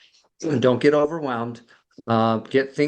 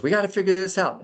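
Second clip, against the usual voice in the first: higher, fast, a touch of mock panic; frantic scramble; 8 semitones higher, faster than usual. The left brain scrambles as anxiety rises, in hurried mock panic. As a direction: rush it like a flustered planner.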